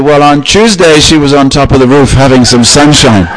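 high, loud, earnest punchline delivery; eager but clueless; comic bumbling announcement. Acted. Neighbour botches the mother news.

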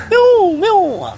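high, loud mock meow; cat meowing. Mouse turned cat meows.

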